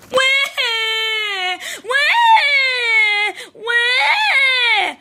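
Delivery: high drawn-out crying wahs; acted baby wail. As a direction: bawl like a little bear cub. Bob cries over lost lion.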